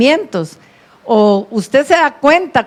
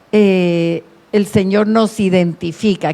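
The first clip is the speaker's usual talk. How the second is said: usual speaking voice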